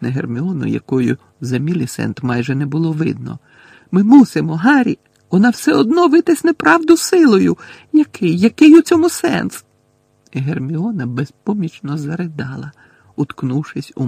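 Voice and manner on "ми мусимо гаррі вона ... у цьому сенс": very high, loud frantic plea; frantic high-pitched outburst; desperate pleading. A narrator's reading. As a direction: plead at the top of the voice. Hermione pleading desperately under threat.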